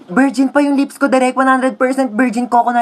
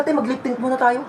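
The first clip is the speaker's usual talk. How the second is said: ordinary talk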